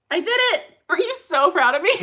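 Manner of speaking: loud, high, gleeful boast; triumphant. Real, not acted. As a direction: celebrate a small win, fishing for praise. Celebrates remembering to start the clock.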